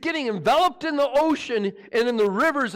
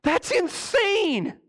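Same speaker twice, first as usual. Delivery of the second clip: incredulous; 10 semitones higher, much slower than usual. Real, not acted.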